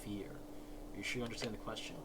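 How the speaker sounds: usual speaking voice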